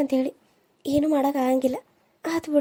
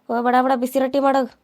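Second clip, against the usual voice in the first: usual speaking voice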